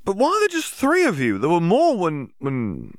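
put-on a young student blurting out a worried question. Student questions Yaxley loudly, then trails off unsure.

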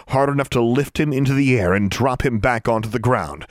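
plain reading voice

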